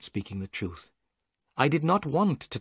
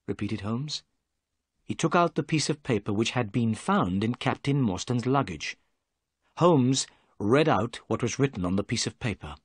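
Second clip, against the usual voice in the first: ordinary delivery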